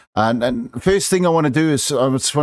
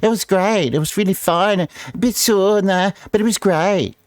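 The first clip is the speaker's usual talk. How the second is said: mocking gushing; 8 semitones higher than usual. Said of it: "talk to people who are vaccinated".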